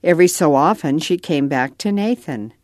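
plain reading voice

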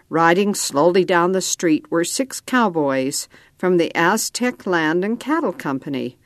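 ordinary delivery, nothing marked

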